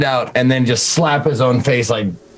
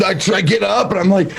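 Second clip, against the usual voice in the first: ordinary talk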